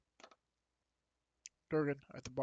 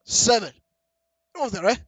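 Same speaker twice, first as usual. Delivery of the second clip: louder, higher barkeep voice; barkeep reading key; 7 semitones higher, 14 dB louder than usual. Acted. Barkeep finds the key on the drunk dwarf, loud and higher. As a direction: call out the room number.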